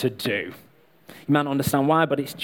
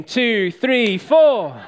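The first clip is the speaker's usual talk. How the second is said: higher and louder, count the song in; 8 semitones higher, 4 dB louder, much slower than usual. Real, not acted. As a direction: shout the count-in before singing, then laugh. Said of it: "Okay, Singing in the Rain".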